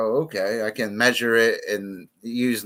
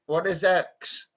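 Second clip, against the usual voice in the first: normal talking voice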